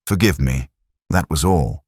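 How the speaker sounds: normal talking voice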